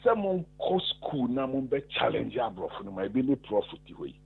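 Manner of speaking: ordinary delivery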